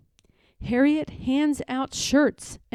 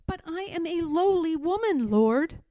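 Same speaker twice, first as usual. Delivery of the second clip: higher, quick humble protest; a humble objection; Harriet protesting; 6 semitones higher than usual. Acted. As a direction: hesitate before the Lord.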